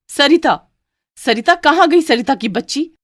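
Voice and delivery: angry yelling. Acted. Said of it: "गुस्से से सरिता को बुलाना".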